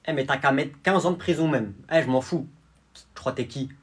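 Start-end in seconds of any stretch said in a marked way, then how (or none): none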